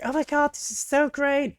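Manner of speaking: high fake excitement; mock overreacting girl. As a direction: parody gushing over nothing.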